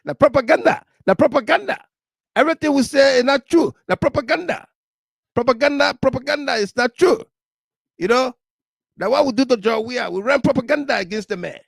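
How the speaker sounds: high, fast mocking politician voice; mock the propagandist in a squeaky voice; hammed-up high mock official; Jabatel voice. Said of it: "Jabatel voice".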